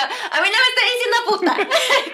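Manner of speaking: high, loud protest through laughter; mock outrage breaking into laughs; laughing indignation. Girl joking about being insulted, laughing protest.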